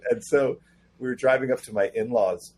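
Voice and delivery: ordinary delivery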